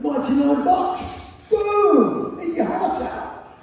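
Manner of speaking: mock triumphant cheer. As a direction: gloat over a bargain. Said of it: "I went".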